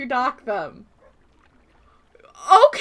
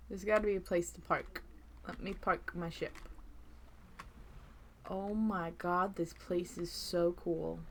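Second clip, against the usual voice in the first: much softer, mumbling to yourself; muttering; 4 semitones lower, 18 dB quieter than usual. Real, not acted. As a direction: talk under your breath. Muttering to self while playing.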